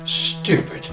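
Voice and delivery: normal talking voice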